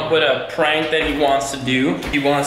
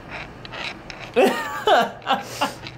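airy, high laughter; breathy laugh; 13 semitones higher, 3 dB quieter than usual. Real, not acted. Reacting to the blue teeth.

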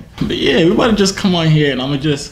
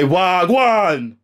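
mock Jamaican greeting; 6 semitones higher than usual. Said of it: "I'm gonna say".

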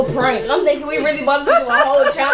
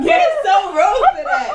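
laughing protest; 7 semitones higher, 3 dB louder than usual. Real, not acted. Daughter laughing at mom's octopus prank, mock outrage. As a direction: scold her while cracking up.

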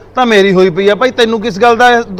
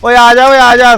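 louder, calling across the yard; 5 semitones higher, 6 dB louder, slower than usual. Acted. Man calls his brother to come over, calling out loud. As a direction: hail him over from a distance.

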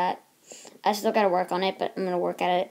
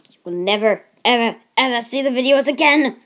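loud, hammed-up warning; mock threat; 3 semitones higher, 8 dB louder than usual. Vlogger warning viewers who don't subscribe, mock threat. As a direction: build loudly to a playful threat.

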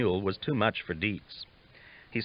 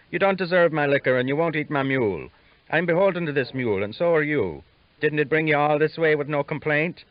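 put-on a higher, louder voice for the stubborn older man, scolding; gruff older man; 7 semitones higher, 5 dB louder than usual. Older man scolding his companion over the mule, raised voice. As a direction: scold the young one, defending your mule.

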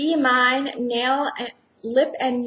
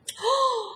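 sharp high gasp of surprise; delighted gasp; 11 semitones higher than usual. Real, not acted. Opening a friend's gift, delighted gasp. Seeing the gift for first time.

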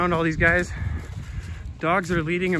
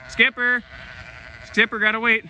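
calling the dog; 6 semitones higher than usual. Real, not acted. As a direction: call Skipper across the field.

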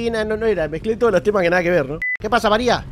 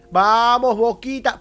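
higher, louder cheer for Boca; 6 semitones higher, 4 dB louder, slower than usual. Real, not acted. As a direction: pump yourself up like a fan. Cheering his football team. (grito de hincha entusiasmado)

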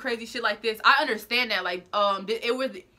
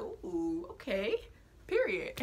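quiet wary ooh; 3 semitones lower, 8 dB quieter, slower than usual. Retold or imitated. Big men rush at her.

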